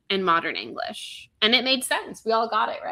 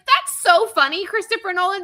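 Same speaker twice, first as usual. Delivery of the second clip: higher, louder mock-sweet sarcasm; sarcastic high; 11 semitones higher, 7 dB louder than usual. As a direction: sneer it with fake delight. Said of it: "And I was like".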